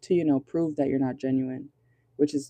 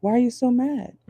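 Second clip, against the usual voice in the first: mock innocent surprise; 3 semitones higher, slower than usual. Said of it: "and then being like".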